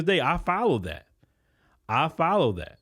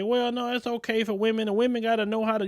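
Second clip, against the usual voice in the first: higher, mocking voice of women who object; mocking women who disagree; 7 semitones higher than usual. Host mocks women who insist they handle cars, pitched-up mock voice. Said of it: "I know some women gonna say".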